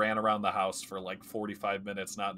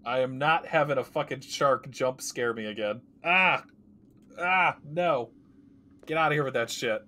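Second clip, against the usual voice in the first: loud panicked yelling; scared horror game streamer; 4 semitones higher, 7 dB louder than usual. Real, not acted. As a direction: yell in real fright at a jump scare. Shark jump scare.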